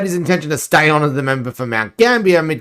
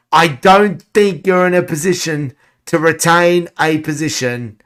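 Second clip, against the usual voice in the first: normal talking voice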